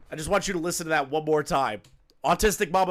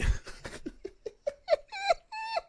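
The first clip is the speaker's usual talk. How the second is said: high wheezy laugh; squealing laugh. Real, not acted. Laughing at own impression.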